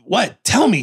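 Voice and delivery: high, quick insistent plea; retold urgent demand. Sly begging his daughter to tell him, urgent. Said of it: "Sly is going".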